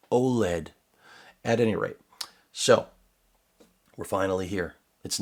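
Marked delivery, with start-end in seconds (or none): 0.1-0.7 oled pronounced "oh-led"; said as a word. Retold or imitated. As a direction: say oled as one word in two syllables. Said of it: "When people tell me".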